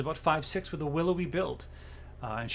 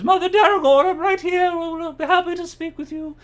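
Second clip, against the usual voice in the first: much higher, louder noble lady voice; gracious duchess; 11 dB louder than usual. Acted. Duchess answers Mother Daragorn across the room, high and loud. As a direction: call out brightly across the hall.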